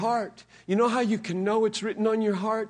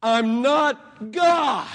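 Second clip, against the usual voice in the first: slow, loud proclamation; slow forceful declaration; shout; 4 semitones higher, 10 dB louder, much slower than usual. Real, not acted. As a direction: hammer each word out. Emphatic answer after whisper.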